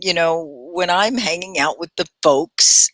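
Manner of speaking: normal talking voice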